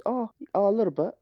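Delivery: high, sheepish mock voice; mimicking a sheepish Aussie. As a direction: squeak out a timid admission. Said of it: "And he goes".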